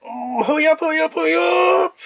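mock frantic, high-pitched hurry; desperate bathroom urgency. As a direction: whine it like you can't hold it any longer. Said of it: "you're like".

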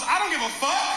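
high-pitched dismissive retort; preacher's mock outburst. Preacher answering the girlfriend, mock angry outburst. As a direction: imitate a preacher brushing her off. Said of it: "He goes".

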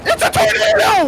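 high alarmed yell; panicked shout. Real, not acted. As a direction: scream the warning. Tornado appears in game.

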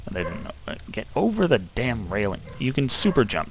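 ordinary talk, nothing marked

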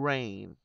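"rain" softly with a drawn-out ay; same ay diphthong. Shown as a demonstration. Teacher giving another example word, long soft diphthong. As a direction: stretch "rain" slowly, e gliding into y. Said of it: "cũng vậy".

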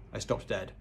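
plain reading voice

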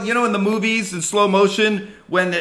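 usual speaking voice

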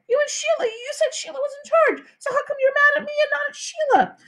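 very high, whiny protest; indignant boy. A narrator's reading. Peter challenging his mother's blame, high indignant whine. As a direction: argue back in a whining child's voice.

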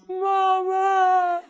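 high, loud drawn-out cry; imitating child calling mama. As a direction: cry out like a kid running to mom. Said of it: "The kid runs".

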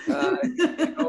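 breaking into a high laugh mid-phrase. Real, not acted. Guest laughing while thanking the hosts, high and amused. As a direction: let a laugh lift the words up high.

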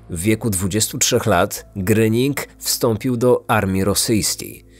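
plain reading voice

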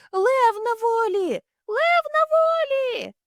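very high, drawn-out shrieks; shrieking crowd. Acted. Zoo crowd shrieks the lion is loose. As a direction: shriek like panicked zoo visitors. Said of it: "верещали".

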